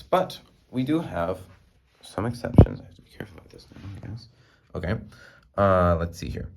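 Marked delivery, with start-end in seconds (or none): 2.8-4.3 whisper. Real, not acted. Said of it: "whispered aside".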